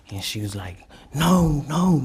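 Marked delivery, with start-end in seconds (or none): 1.1-2.0 high, loud retold "no"; distressed refusal. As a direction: imitate a panicked refusal. Retold refusal to hand over baby.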